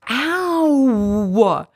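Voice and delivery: high, loud, stretched melodramatic ow; milk Ow! into a huge, wailing, many-syllable cry; overblown cry of ow. Retold or imitated. Mom shows her daughter a dramatic ow. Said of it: "You turn that ow into a twelve syllable word".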